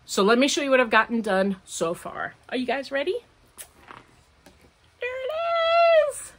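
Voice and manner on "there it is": high and slow, showing off the knitting; proud reveal. Real, not acted. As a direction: draw out the big reveal with delight.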